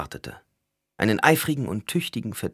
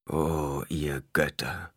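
slow, soft pained groan; 3 semitones lower, 5 dB quieter, much slower than usual. A narrator's reading. Stilgar sees Paul's ruined eyes, slow soft groan. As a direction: let the words ache out slowly. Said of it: "ächzte er".

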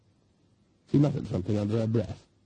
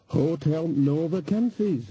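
higher and cheerier repeat; brightened up; 5 semitones higher, slower than usual. Acted. Landlady repeating the sign to guests, brightly. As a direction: perk up and sell it. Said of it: "and then brightened up".

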